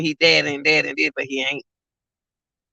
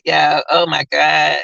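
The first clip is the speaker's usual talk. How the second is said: usual speaking voice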